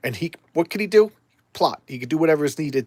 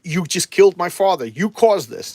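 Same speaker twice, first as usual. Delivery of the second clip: raised, louder retold blame; hammed-up bitter accusation; mock accusation; 4 semitones higher, 6 dB louder than usual. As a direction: accuse him with acted outrage. Daughter accuses John of killing father.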